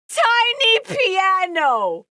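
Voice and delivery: high, loud and drawn out; excited exclaim. Real, not acted. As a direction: cry it out in delighted disbelief. Reacting to the odd object.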